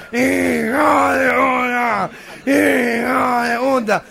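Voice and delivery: cántico nasal y arrastrado; multitud de gangosos. Acted. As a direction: corea despacio como un gangoso imitado. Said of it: "todos como cinco mil gangosos". (gangosos coreando con voz nasal)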